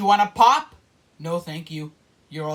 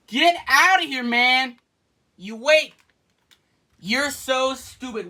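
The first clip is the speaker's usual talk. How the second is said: usual speaking voice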